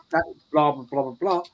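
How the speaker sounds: ordinary delivery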